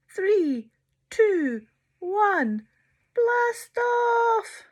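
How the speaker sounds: loud, rising countdown. Acted. Sheila counting down again, loud and high. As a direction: shout the blast off.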